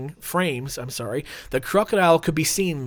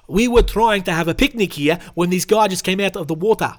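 louder, put-on South African voice; South African accent; 2 semitones higher, 5 dB louder than usual. Picnic victim recounting the crocodile's arrival, South African accent. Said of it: "Let's do a South African voice".

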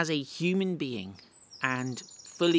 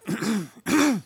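loud throat clearing; 7 semitones higher, 6 dB louder than usual. Real, not acted. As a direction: clear your throat before going on. Pauses mid-sermon.

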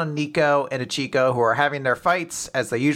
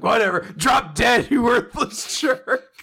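mock snarl; 8 semitones higher than usual. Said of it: "He's like".

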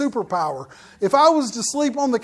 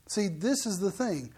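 usual speaking voice

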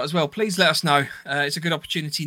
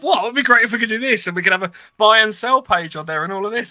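high, loud, eager rush of ideas; excited gushing; 5 semitones higher, 4 dB louder than usual. Retold or imitated. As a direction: gush like a kid with big plans. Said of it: "These two went freaking mental".

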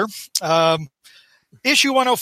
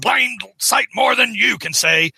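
blustering comic-book boss shout; J. Jonah Jameson; 3 semitones higher than usual. Acted. Jonah answers the protester who announced him, hammed-up shouted bluster. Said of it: "That's a good Jameson".

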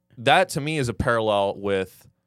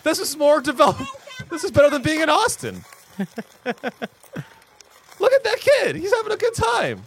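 excited; 13 semitones higher than usual. Real, not acted. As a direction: gush over the video. Reacting excitedly to video.

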